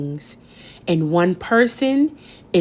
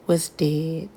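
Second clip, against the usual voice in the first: hushed; 3 semitones lower, 6 dB quieter than usual. A narrator's reading. Mother's death revealed.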